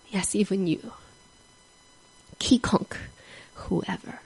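soft, fading gentle answer; tender reassurance. Retold or imitated. Albert reassuring the convict, soft and tender. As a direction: comfort him quietly.

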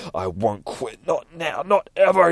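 strained resolve rising in pitch; building defiance. Acted. Beaten Nightwing refusing to quit, rising defiance.

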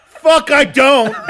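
high loud laughing outburst; laughing shout. Real, not acted.

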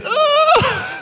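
high-pitched mock panic cry; panicked squeal. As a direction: let out a comic high squeal of alarm. Guitarist's panicked noise, acted out.

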